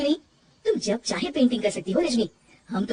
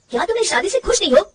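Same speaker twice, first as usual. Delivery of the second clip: higher, louder, pleading question; husband asking if she is unhappy in marriage; pained demand; 9 semitones higher, 6 dB louder than usual. Acted. Husband confronts wife. (ऊँची आवाज़ में दुखी सवाल)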